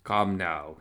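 usual speaking voice

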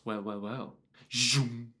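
low, hushed villain greeting; Darth Maul. Retold or imitated. Imitating Darth Maul's few words, low and hushed. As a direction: murmur it low like a lurking villain. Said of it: "he's all like".

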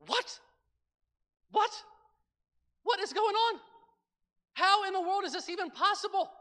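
high, excited mock astonishment; retelling shock at the girl's singing, high and bright; amazed disbelief. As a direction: gasp it out in wonder. Amazed reaction retold.

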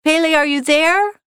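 trapped boss calling loudly for his assistant; called out. A narrator's reading. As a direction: call out to her through the closed door. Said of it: "He called out".

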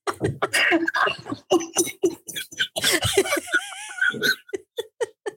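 long high wheezing laugh; laughing until out of breath; breathless laughing fit. Real, not acted.

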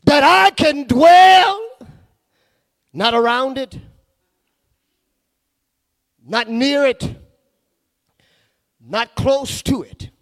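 high, loud, stretched-out preaching cry; preaching at full force. Acted. Preacher crying out to the church to dwell with God, soaring whoop. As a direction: whoop it out to the congregation, slow and soaring.

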